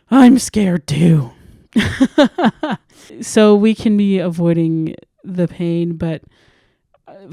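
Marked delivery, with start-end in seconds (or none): 0.1-1.3 loud, slow confession breaking into a laugh; laughing admission. Retold or imitated. Admitting fear, laughing it off. 1.7-2.8 loud embarrassed burst of laughter; real laugh. Real, not acted. Laughs after own confession.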